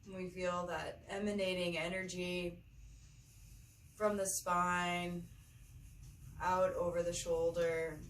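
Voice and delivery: unhurried meditation guide, long pauses between phrases; measured, spacious yoga-teacher delivery; guided meditation. Acted. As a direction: lead them gently through the breath, phrase by phrase.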